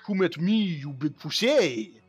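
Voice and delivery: put-on high, laughing French-accented taunt; bad French accent. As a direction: mangle it in a silly French accent. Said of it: "it almost sounds like".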